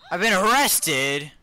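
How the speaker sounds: high, loud, stretched-out cry; outraged shout. Pretend. Player just arrested in game, outraged shout.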